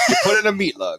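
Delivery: put-on high, loud old-time pitchman; 1950s commercial voice. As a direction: sell the meat lug like a vintage ad.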